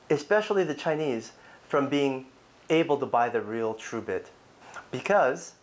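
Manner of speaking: ordinary talk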